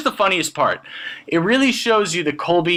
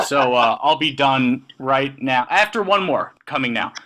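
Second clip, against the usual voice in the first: ordinary delivery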